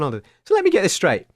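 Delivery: ordinary delivery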